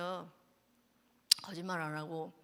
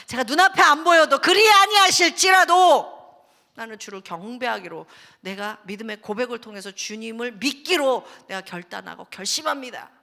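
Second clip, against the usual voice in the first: fervent declaration; 14 dB louder than usual. Retold or imitated. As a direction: proclaim it with full force. Declaration of faith. (크고 높게 선포하는 믿음의 고백)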